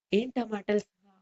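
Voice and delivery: plain reading voice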